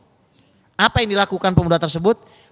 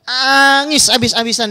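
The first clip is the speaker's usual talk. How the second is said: hammed-up wail, then back to telling; mock wailing; 8 semitones higher, 4 dB louder than usual. Preacher telling how the youth cried, mock wailing.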